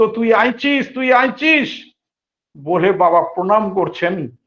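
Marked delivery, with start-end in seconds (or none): 0.0-0.9 overjoyed. Retold or imitated. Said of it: "আনন্দে আপ্লুত". 0.9-1.9 high, slow, ecstatic তুই আইছিস; overjoyed welcome. Retold or imitated. Thakur greeting Baba on arrival, overjoyed. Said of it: "আনন্দে আপ্লুত".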